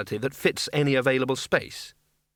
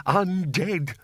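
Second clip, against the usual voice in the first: teasing spooky moan, pushed further; 5 semitones higher than usual. Acted. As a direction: lean harder into the ghostly tease. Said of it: "Oh, stop teasing him".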